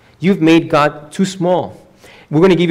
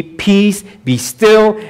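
high, forceful command to the sea; commanding Jesus; 8 semitones higher, 4 dB louder, much slower than usual. Acted. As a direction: order the storm down, raised voice. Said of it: "said to the sea".

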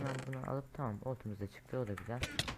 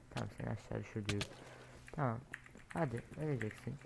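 usual speaking voice

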